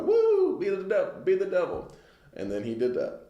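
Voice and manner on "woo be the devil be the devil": high-pitched mock crowd whoop; cheering crowd. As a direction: imitate an excited crowd chanting along. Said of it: "everybody was like".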